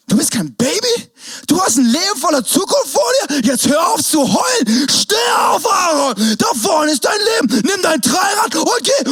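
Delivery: rally him like a coach; mock pep shout. Big cousin shouting the toddler back on his feet, mock pep shout.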